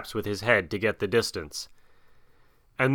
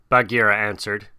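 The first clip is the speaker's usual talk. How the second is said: ordinary delivery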